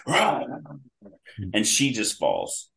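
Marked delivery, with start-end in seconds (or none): 0.1-0.5 high, short imitated roar; mighty roar. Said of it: "lets out this mighty".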